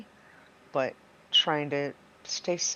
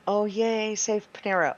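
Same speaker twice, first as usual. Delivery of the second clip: mock cheer; 7 semitones higher, 5 dB louder than usual. Mock cheer in Ro's mind.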